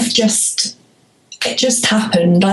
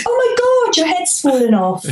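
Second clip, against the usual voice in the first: mock shock; 10 semitones higher, faster than usual. Mock panic before tea joke.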